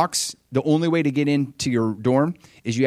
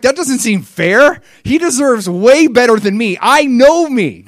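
mock protest; 8 semitones higher, 7 dB louder than usual. Speaker protests he doesn't deserve God.

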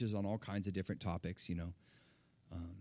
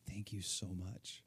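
soft thanks; 4 dB quieter than usual. Retold or imitated. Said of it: "she was like".

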